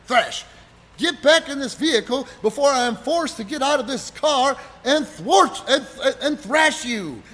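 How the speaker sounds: loud, high mock ultimatum; imitated pompous threat. Ron threatening to thrash him from car, loud mock ultimatum. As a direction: deliver a stilted threat at high volume.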